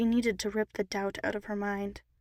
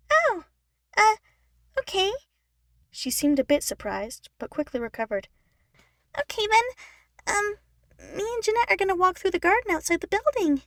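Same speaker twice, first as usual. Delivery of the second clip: much higher little sister, louder, quick; Eleanor, surprised then hopeful; 10 semitones higher, 7 dB louder than usual. Acted. Eleanor invites Brittany to the garden, hopeful. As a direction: recover and invite her along.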